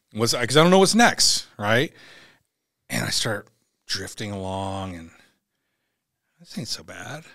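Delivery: ordinary talk, nothing marked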